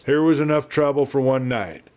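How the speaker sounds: ordinary delivery, nothing marked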